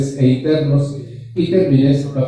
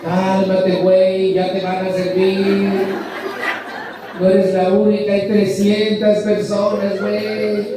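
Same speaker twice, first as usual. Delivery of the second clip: high, fed-up voice telling someone to calm down; mock scolding a whiny complainer, much higher; exasperated scolding; 9 semitones higher than usual. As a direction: play a shrill, annoyed friend losing patience.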